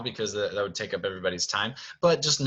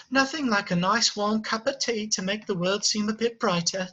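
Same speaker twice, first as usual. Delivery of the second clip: teapot housekeeper; 10 semitones higher than usual. Acted. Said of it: "and she says".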